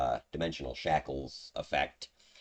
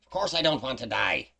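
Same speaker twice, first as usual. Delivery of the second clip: higher imp voice; exasperated imp; 6 semitones higher, 4 dB louder than usual. Acted. Game master's imp answers a death threat, exasperated.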